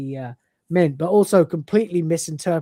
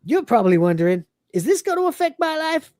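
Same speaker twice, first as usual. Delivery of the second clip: high mock-wise Cat building to a shouted yes; smug reasoning that pops up into a shout; Cat impression; 5 semitones higher than usual. Cat pondering Lister's arm, then blurting high. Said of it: "He goes".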